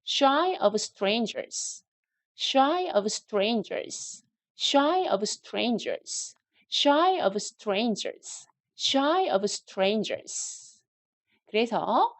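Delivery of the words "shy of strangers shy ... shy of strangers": very slow, careful "Shy of strangers"; slow pronunciation drill. Shown as a demonstration. Teacher drilling "Shy of strangers" slowly for learners. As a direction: stretch each word for repetition. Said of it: "발음 연습하시기 위해서 shy of strangers를".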